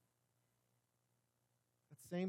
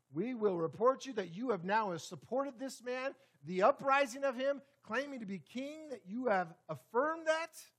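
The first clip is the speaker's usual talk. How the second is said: higher, louder threatening report; threat; 6 semitones higher, 6 dB louder than usual. Retold or imitated. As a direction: lean in with menace, higher and louder. Leaders threatening Pilate.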